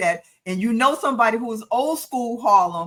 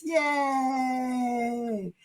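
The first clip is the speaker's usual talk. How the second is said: long drawn-out, higher yay; celebratory cheer; 4 semitones higher, 5 dB quieter than usual. Pretend. Host cheering the first show of the year, drawn-out cheer. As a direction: hold the cheer out in one long breath.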